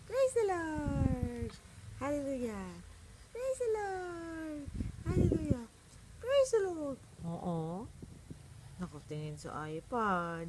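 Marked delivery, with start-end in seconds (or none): 0.1-7.0 high, drawn-out cooing praise. Real, not acted. Parent chants praise to the baby, high sing-song baby talk. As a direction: coo it up high, letting each phrase glide down.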